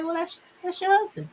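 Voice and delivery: high, soft imitation of mom; mother's voice. Mom answering daughter about her husband, high soft imitation. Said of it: "she would just say".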